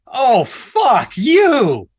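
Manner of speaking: loud, high, drawn-out curse; exaggerated curse. Shown as a demonstration. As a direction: stretch every word out loud and high. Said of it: "I just said it three different ways".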